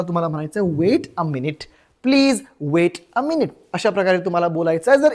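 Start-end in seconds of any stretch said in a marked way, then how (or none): 0.6-3.5 very slow, spaced-out request; slow teaching model. Shown as a demonstration. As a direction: model each word slowly for learners. (शिकवण्यासाठी अगदी सावकाश)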